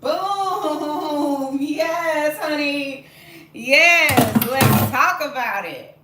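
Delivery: loud, high, drawn-out celebration; triumphant shout. Real, not acted. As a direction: holler in victory, stretching the words. Celebrates cake coming out intact.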